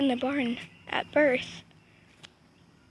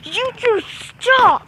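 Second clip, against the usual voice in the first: slow, louder warning to two cats; slow firm scolding; 5 semitones higher, 5 dB louder, much slower than usual. Real, not acted. Scolding two fighting cats, slow and firm. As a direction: drag out stop like a firm telling-off.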